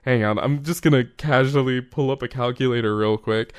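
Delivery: ordinary delivery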